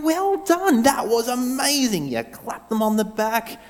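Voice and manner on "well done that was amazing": higher, cheery praise; praising teammate. Shown as a demonstration. Leader acting a teammate praising a mate, cheery. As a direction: clap them on the back with words. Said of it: "You go".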